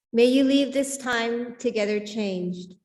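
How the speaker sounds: ordinary delivery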